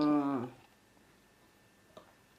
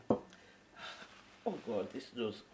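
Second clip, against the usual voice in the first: breathless whisper, lower pitch; whispered groan; 7 semitones lower, 16 dB quieter, much slower than usual. Acted. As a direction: whisper it as the sneeze builds. Sneeze coming, whispered.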